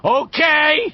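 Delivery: high, loud bark; bully snapping down the shaft; angry shout. A narrator's reading. Henry shouting down at the kids in anger. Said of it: "he shouted down at them".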